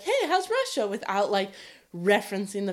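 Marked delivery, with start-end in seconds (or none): 0.0-0.9 breezy question. Retold or imitated. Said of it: "just be like".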